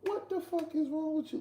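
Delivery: high exasperated cry at a friend; retelling telling off a friend, high exasperated outburst; mock mad outburst. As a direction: blurt it out, fed up and in disbelief. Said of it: "Like, I got so mad".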